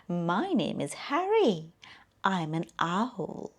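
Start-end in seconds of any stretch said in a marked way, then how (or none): none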